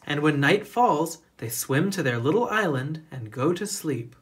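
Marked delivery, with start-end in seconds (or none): none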